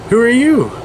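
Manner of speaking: a hint of a dotty old mother asking; crazy mom voice. Retelling the crazy mom in court, lightly voiced. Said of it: "And then the crazy mom".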